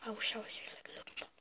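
fast, secret whisper. Acted. A kid whispering his wish to be like Logan Paul, whispered. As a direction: breathe the wish fast under your breath.

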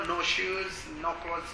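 usual speaking voice

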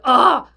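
loud exasperated "oh"; mad groan. Retold or imitated. Frustrated customer venting after the call, mad groan. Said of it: "I was so mad".

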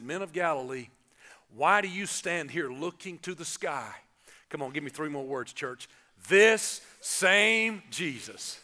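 usual speaking voice

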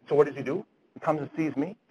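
ordinary talk, nothing marked

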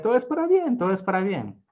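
high, bright-hearted refrain; lightly voiced glad refrain; cheerful motto. A narrator's reading. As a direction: repeat it with faith and joy. Simha's repeated motto.

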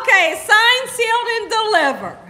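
loud, high triumphant crowing; delighted. Real, not acted. Wife hearing her cake beats Miss Georgia, delighted. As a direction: crow it out, thrilled and laughing.

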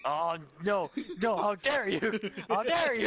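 high, loud laughing outrage; hammed-up how dare you; mock outrage.

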